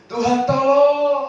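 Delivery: high, loud plea; praying with a loud call for help; loud cry for help. Acted. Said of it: "pertama seru keras".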